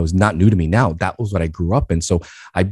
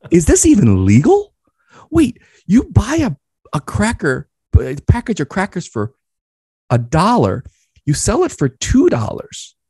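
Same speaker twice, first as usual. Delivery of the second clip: much higher, excited disbelief at a doubled price; astonished teen; 12 semitones higher than usual. Retold or imitated. Boy realizes his dad's markup, higher and amazed. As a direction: gasp out the discovery wide-eyed.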